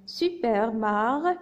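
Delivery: slow, drawn-out syllables. Shown as a demonstration. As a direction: stretch each syllable for young readers. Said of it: "j'ai séparé le texte en syllabes".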